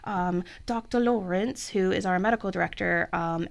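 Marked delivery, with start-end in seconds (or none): 0.7-1.7 put-on a light, slowed British accent on the name; hint of a British accent, half joking. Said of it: "say it in a British accent".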